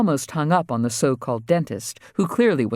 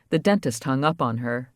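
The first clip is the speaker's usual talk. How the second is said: ordinary delivery, nothing marked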